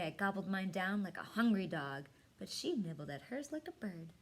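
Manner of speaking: ordinary delivery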